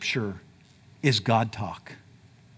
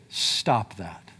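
God's firm command, lower and softer; 9 semitones lower, 5 dB quieter than usual. Retold or imitated. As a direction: speak as God, calm and firm, lower and softer. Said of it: "God will say".

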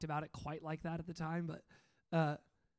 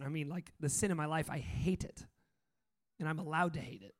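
ordinary delivery

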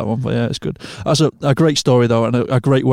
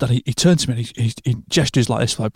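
ordinary talk, nothing marked

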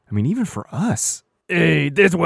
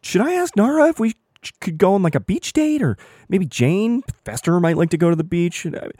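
much higher, fast and eager; excited pleading; 7 semitones higher than usual. Acted. Werewolf character pleads with the audience for a beach episode, high and eager. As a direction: beg the audience breathlessly.

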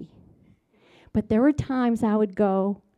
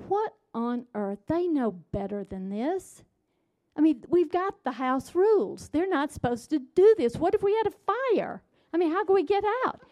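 higher-pitched, building alarm and exasperation; escalating indignation; 5 semitones higher than usual. Retold or imitated. Mom finding the mess in the foyer, escalating indignation. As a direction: ramp up from a mutter to high-pitched protest. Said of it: "it just all ramped up".

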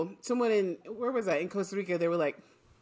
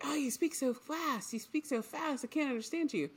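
mock complaining local; 8 semitones higher, 5 dB quieter than usual. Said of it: "people here would be like".

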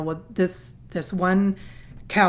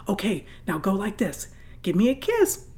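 little daughter coaching. Retold or imitated. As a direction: show her how like a little teacher. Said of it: "my little nine-year-old bends down and goes".

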